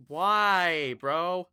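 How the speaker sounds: loud, high frustrated cry of why; real gamer frustration; exasperated yell. Real, not acted. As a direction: yell it at the screen in disbelief. Frustrated outburst at game mishap.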